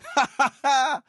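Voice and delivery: a short high chuckle; a quick amused laugh. Acted. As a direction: laugh at the absurd tale. Laughs at the relic story.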